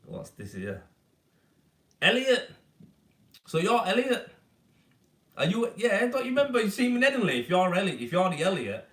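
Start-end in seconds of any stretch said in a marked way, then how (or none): 2.0-8.9 real surprise, high and loud; excited recognition. Real, not acted. Recognises an old pupil in chat.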